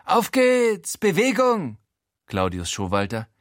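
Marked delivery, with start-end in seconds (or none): 0.0-1.8 high, drawn-out cocky call; antreibender Zuruf. A narrator's reading. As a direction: herd the captives along. Frankie treibt die Geiseln an. (hoher, kommandierender Zuruf)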